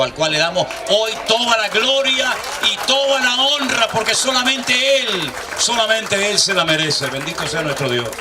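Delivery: high, loud, soaring preacher's cry; full-force preaching. Acted. Pastor exalting the Lord before his congregation, full-force preaching. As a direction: lift it up and proclaim it to the whole church.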